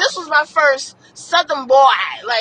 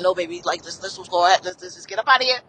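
put-on soft to the child; 8 semitones lower, 5 dB quieter than usual. Mother gently answering daughter.

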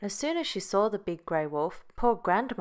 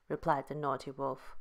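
usual speaking voice

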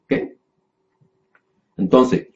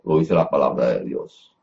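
ordinary talk

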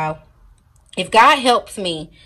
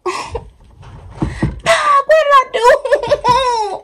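very high, slow, drawn-out wail; half laughing, half crying in despair; near-tears wail; much slower than usual. Real, not acted. Vlogger regrets gluing on her lashes, wailing tearfully. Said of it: "I'm about to start crying".

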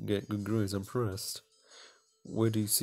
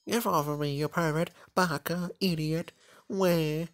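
higher, louder furious yell; flustered yell; 7 semitones higher, 3 dB louder, slower than usual. Acted. Girl yelling at the boy who fell on her, higher and louder. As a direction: shove him off with a shriek of words.

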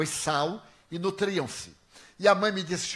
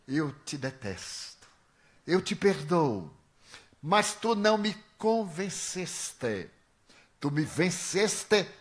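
slow solemn spirit voice; 3 semitones higher, slower than usual. Retold or imitated. Spirit's solemn farewell to speaker.

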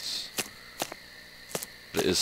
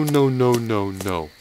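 usual speaking voice

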